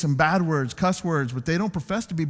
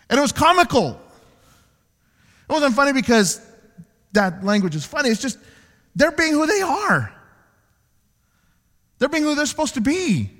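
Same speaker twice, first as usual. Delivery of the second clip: preaching; 7 semitones higher, 5 dB louder than usual. Acted. Preaching at full force.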